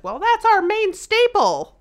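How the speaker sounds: high, loud, drawn-out mock protest. Imagined slavery defender protesting about losing their staple, mock protest. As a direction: raise it high and stretch it out loudly.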